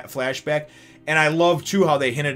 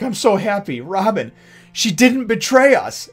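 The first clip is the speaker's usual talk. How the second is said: high, loud and slow, overjoyed relief; tearful joy; 8 semitones higher, 8 dB louder than usual. Acted. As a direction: voice Nami's tears of joy. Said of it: "She's just like".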